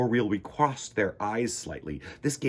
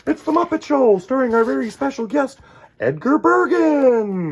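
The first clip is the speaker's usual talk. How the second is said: much higher, louder, stretched announcement; show announcer; 13 semitones higher, 9 dB louder than usual. Acted. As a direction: introduce the show like a host. Showbiz announcer intro.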